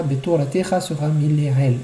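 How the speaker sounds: normal talking voice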